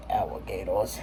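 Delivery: New Orleans accent. Shown as a demonstration. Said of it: "say it like you're from New Orleans".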